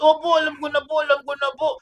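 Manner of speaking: high, urgent acted plea; child pleading. Child telling the teacher they understand grace, pleading high. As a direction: blurt it high like a kid giving in.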